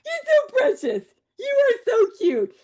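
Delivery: put-on high, cooing baby-talk voice. Cooing to a furious Walmart shopper in high baby talk. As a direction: coo at her like a grumpy baby.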